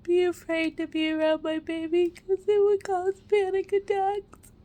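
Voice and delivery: crying through the words; snotty crying. Real, not acted. Mother confessing postpartum panic, tearful.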